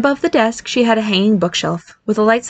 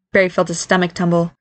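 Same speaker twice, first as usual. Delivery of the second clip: ordinary delivery, nothing marked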